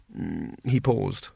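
ordinary delivery

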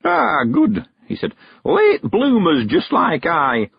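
put-on high old countryman voice; old man sending the girl on an errand, high and eager; Mister Weevil. A narrator's reading. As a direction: lift into a high, chatty old man's voice.

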